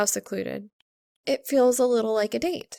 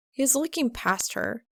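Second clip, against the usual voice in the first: usual speaking voice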